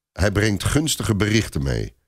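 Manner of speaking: usual speaking voice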